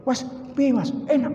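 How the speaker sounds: high, far-off voice asking a guest; host checks if the food is good; acted host asking. Host asks the guest eagerly. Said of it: "Njur kon crita".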